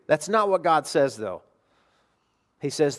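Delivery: usual speaking voice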